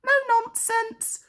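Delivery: sharp Percy. A narrator's reading. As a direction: snap the trucks into line. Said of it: "puffed Percy sharply".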